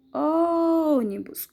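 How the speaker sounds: "Ônibus" with a very long held Ô; stressed first syllable. Shown as a demonstration. Teacher shows pupils the strongest syllable, Ô held long in "Ônibus". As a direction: hold the Ô of "Ônibus" slowly, then finish. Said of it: "é a sílaba mais forte".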